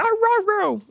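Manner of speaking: high howling dog rawrs; dog impression. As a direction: yowl like a talking dog. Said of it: "He'll be like".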